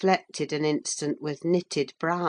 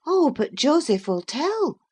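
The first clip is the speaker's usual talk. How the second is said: Catherine suggesting; 10 semitones higher, 3 dB louder than usual. A narrator's reading. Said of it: "she suggested".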